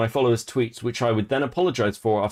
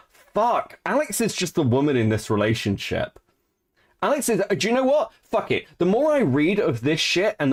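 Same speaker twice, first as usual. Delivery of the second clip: exasperated outburst; 8 semitones higher than usual. Real, not acted. Exasperated outburst reading drama.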